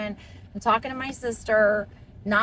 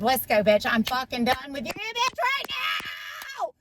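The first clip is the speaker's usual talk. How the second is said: retold scream. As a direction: scream it, clapping, from the car seat. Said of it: "I scream like that".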